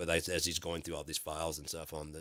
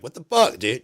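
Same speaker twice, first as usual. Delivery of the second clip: loud, high-pitched exclamation; mock outrage; 7 semitones higher, 14 dB louder than usual. Guard catching someone slipping out, mock outrage. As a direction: burst out in pretend indignation. Acted reaction of someone startled.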